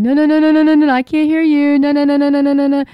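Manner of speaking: hammed-up fingers-in-ears refusal; mocking a stubborn refuser. Mocking the Jehovah's Witness who won't listen, high loud hammed-up no's. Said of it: "He's like".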